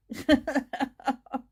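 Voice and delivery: real merry chuckling burst; hearty amused laugh. Real, not acted. Laughs after greeting the latecomer.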